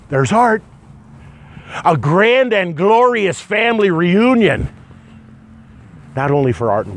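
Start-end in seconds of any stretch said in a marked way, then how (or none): 0.1-4.7 bright, higher, joyful announcement; light up picturing the reunion; joyful excitement. Real, not acted. Picturing loved ones in heaven.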